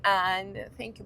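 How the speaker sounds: ordinary delivery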